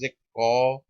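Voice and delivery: very slow, syllable by syllable; slow sounding-out. Shown as a demonstration. Teacher showing students how to hear each sound, slow sounding-out. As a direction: stretch "cause" out sound by sound. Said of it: "ध्यान से slow पढ़ के देखो".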